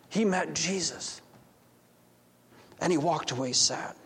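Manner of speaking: soft, low, sorrowful breath of dismay; saddened. Acted. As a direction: mourn the friend under the breath. Zacchaeus grieves for his friend.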